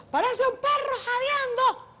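high, slow mock voice for the director; imitating the director. Actress quoting director Humberto, in a high mock voice. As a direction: ham up the director's teasing remark. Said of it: "Y Humberto decía".

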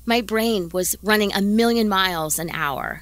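usual speaking voice

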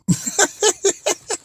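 squeaky high laugh cracking up; high giggle. Real, not acted. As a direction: let a high giggle burst out. Laughing at his own skit.

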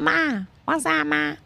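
imitated squeaky little kid; very high, drawn-out mock baby voice; high-pitched voice. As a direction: call for Ma in a put-on high squeak. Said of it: "like, a high-pitched voice".

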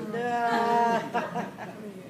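high laugh at his own joke. Real, not acted. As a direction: chuckle at the punchline.

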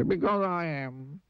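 high, slow, woeful whine; sad squeaky voice. Acted. Mister Miserable answers Mister Happy, sad and squeaky. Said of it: "Sad, squeaky sort of voice".

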